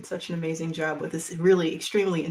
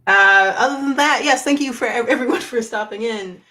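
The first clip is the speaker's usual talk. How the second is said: loud excited sign-off; 5 semitones higher, 12 dB louder than usual. Real, not acted.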